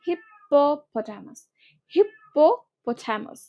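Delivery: হিপ্পোপোটামাস said slowly, every syllable drawn out; careful pronunciation. Shown as a demonstration. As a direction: stretch out হিপ্পোপোটামাস like a teacher modelling it. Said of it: "আজকে থেকে বলবা".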